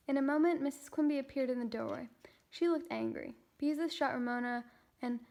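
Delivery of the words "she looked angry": ordinary delivery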